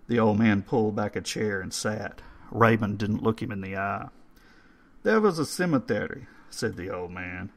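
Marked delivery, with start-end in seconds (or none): 5.0-6.3 put-on old caretaker voice. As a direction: read the stone like a courteous old man. Old man tells his story.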